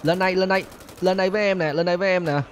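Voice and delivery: bait them cheekily; teasing call. Real, not acted. Taunting police to climb up.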